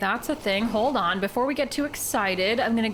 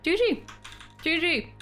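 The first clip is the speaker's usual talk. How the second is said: excited GG; 11 semitones higher than usual. Real, not acted. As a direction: cheer it like a win. Calling GG after surviving.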